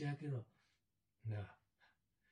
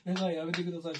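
higher, louder mocking imitation of her begging; mock pleading; 5 semitones higher, 6 dB louder than usual. Taunting the captive by echoing her begging, mock plea. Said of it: "お前懇願してんだよ".

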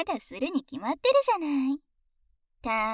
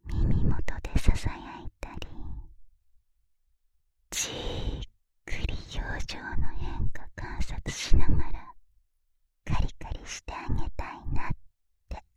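whispering; 7 dB louder than usual. Acted. Describes plan in a whisper.

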